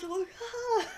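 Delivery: high, laughing mock gasp; squeaky strained little grunt; daughter quote. Imitating the daughter pushing the wheelchair, squeaky. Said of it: "And she goes".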